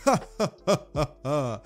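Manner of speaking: high, staccato put-on belly laugh; mock hero laugh. All Might welcoming Bakugo to the team, mock hearty laugh. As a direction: laugh like a back-slapping superhero.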